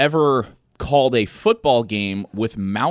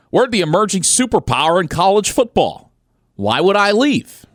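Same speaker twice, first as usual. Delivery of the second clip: put-on Pittsburgh Yinzer accent, pitched high and loud; 10 semitones higher, 5 dB louder than usual. Host quoting Cignetti in a put-on Pittsburgh Yinzer accent. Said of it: "I'm gonna put on my best Yinzer accent for this".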